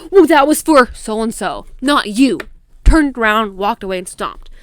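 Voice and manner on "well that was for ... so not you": loud imitation of a huffy comeback; snippy retort. Dude brushes her off before stomping away, snippy. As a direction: throw it back loudly, imitating his huff. Retold snippy reply in quarrel.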